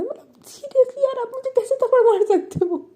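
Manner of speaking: very high, squeaky put-on outrage; outraged girlfriend. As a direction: mimic her wounded indignation. Acting Prachi's outraged complaint. (बहुत ऊँची, नकली नाराज़ आवाज़ में)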